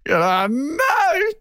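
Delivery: high, panicked inner voice; mock panic. Voiceover actor frozen in the booth, in high mock panic. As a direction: squeal it out like a man watching his job slip away. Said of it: "He sat there frozen".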